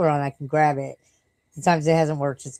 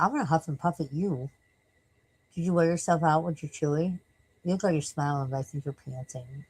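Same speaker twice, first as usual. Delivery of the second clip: usual speaking voice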